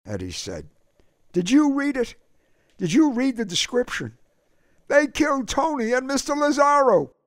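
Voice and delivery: put-on urgent young Eddie. A narrator's reading. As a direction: make him look at the picture now. Eddie shows K O the murder photo.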